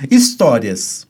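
ordinary talk, nothing marked

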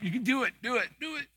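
high, soft, coaxing chant; mock-encourage someone sweetly; coaxing cheerleader. Pastor imitating a member urging someone to help, soft high coaxing. Mimicking a church recruiter.

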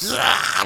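a sudden high, playful monster "Rah!"; jump-scare growl, hammed up; mock demon roar. Demon crawling out of hell.

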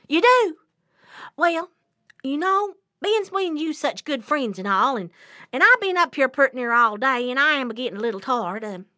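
loud, quick, persuasive rabbit voice; trickster rabbit. Acted. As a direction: sell the deal in a lively patter. Rabbit cons the bear.

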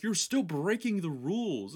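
high mock-prissy voice; mocking whine. Mocking the scolding guy.

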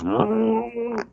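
high, soft mock roar; a feeble little lion roar; sick lion spitting up, mock roar. Voicing lion about to spit.

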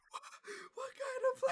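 very high strained cartoon voice; dying SpongeBob. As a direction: gasp it out with no air left. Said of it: "He's like".

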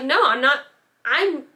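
imitating a mother snapping back. Mother snapping at daughter in boyfriend's apartment, mild mock snap. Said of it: "she snapped at this point".

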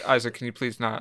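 ordinary talk

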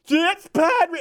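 high loud yell broken by laughter; laughing through the dad's yell; mock yell dissolving into laughs; repeat yell. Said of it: "he just kept doing it".

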